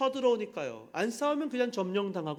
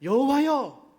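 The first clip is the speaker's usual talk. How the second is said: a loud, raised cry of 여호와여 with a falling end; crying out to the Lord; 4 semitones higher, 6 dB louder, slower than usual. Acted. A preacher voicing king Asa calling out loudly to God. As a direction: call 여호와여 out loud like a desperate king's plea. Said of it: "뭐라 그럽니까?".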